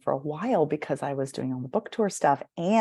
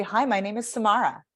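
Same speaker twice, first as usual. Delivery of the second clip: firm falling end on Samara; confident statement; 4 semitones higher than usual. Shown as a demonstration. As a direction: land the name with a clear period. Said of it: "throw that ball and it hits the person".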